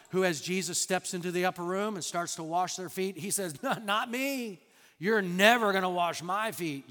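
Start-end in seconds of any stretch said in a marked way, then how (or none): 3.6-6.9 raised, emphatic objection; indignant refusal. Retold or imitated. Peter refusing to let Jesus wash his feet, indignant. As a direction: refuse outright, pushing the voice up.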